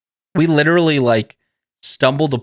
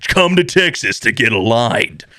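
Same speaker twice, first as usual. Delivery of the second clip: mock infomercial intro, drawn out; chiropractor promo guy; 4 semitones higher, slower than usual. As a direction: announce yourself like a promo video host. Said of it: "montage in the beginning of this guy".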